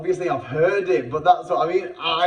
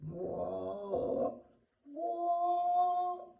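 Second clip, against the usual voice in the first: rising, breathy, amazed whoa; a hushed exclamation of awe, climbing higher; awed whoa; 7 dB quieter than usual. Retold or imitated. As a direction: let the wonder lift the voice high. Overwhelmed moment of awe retold.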